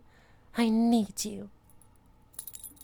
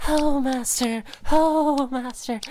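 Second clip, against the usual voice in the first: ordinary talk, nothing marked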